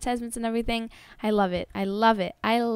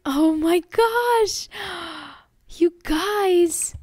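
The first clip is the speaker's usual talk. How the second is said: high, slow, breathless awe; awed gasp; 6 semitones higher, 4 dB louder, much slower than usual. Real, not acted. Vlogger awed by her bride photo, breathless. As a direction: let it out slowly, overwhelmed.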